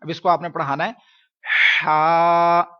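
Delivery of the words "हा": हा pushed out loud from the middle of the throat; teacher sounding हा from the throat; throat ha demo. Shown as a demonstration. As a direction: breathe हा hard from the throat. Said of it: "ये आवाज लाजमी निकलवाएं".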